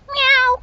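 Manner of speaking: high playful meow; cat call meow; imitated meow. Real, not acted. Meowing at the cat.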